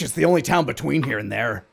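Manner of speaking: insistent. Acted. Official insisting.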